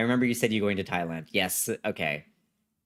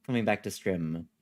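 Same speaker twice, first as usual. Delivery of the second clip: ordinary delivery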